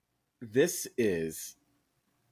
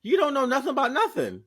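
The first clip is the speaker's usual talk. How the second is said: playful scolding; 14 semitones higher, 5 dB louder, faster than usual. Real, not acted. Teasing Adam about New York.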